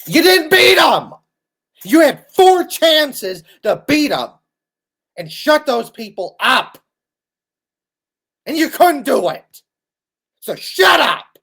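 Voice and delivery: high, loud furious scolding; real frustrated shouting; angry shouting rant. Real, not acted. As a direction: yell at the fans to shut up. Ranting at Ohio State fans.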